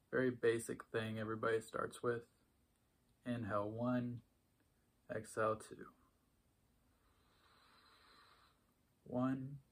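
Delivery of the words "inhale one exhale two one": soft, unhurried meditation voice with long pauses; hushed, even cues for noticing breath and body; guided meditation. Acted. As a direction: guide a quiet body scan, leaving space after each phrase.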